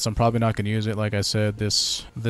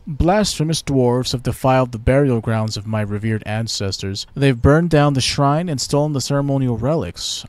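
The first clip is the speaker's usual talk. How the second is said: haughty elf, a touch louder; elf ambassador; 4 semitones higher, 6 dB louder than usual. Pretend. Elven ambassador greets the new Lord Protector, haughty. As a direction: greet the human with lofty pride.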